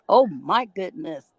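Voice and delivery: usual speaking voice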